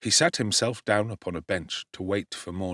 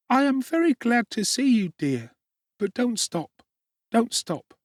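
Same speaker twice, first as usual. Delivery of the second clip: put-on much higher, louder little child's voice; small child Dick; 3 dB louder than usual. A narrator's reading. Sick little Dick answering Oliver, high child voice. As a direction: answer as a frail small boy.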